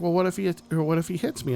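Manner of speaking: a hint of a scared eight-year-old asking dad; worried little daughter. Young daughter asks her father fearfully.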